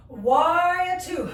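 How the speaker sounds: ordinary talk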